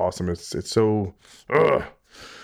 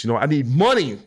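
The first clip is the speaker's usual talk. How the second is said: mock greedy; 4 semitones higher, 4 dB louder than usual. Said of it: "I'm just kidding".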